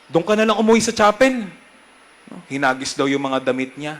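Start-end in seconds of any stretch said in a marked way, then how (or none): 0.0-1.5 quoting the angry father, higher, louder and fast; mock yell of a scolding father; retold scolding. Priest retelling how the father yelled at his son, a mock yell. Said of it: "Sinigawan ako".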